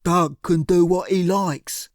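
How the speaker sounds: slow, heavy thug voice. A narrator's reading. Cyril's thug voice.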